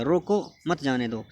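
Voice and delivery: "रोको" lifted on its own, then "मत जाने दो" falling; pause after रोको. Shown as a demonstration. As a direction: split the sentence right after रोको. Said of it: "ये निशान लगा हुआ है रोको के बाद".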